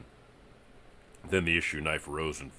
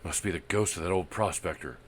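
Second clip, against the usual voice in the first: hoarse whisper; 4 semitones higher, 6 dB louder than usual. Acted. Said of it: "a hoarse voice whispered through the gloom".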